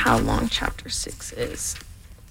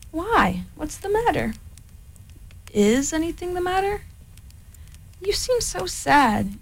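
higher, gentle worried bear; concerned Pooh; 7 semitones higher than usual. Acted. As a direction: ask kindly, a little anxious. Pooh's concerned voice.

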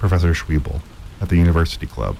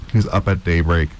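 ordinary delivery

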